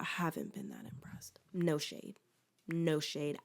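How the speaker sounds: hushed aside; whispering. Real, not acted. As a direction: whisper it like a secret. Confiding a mild diss.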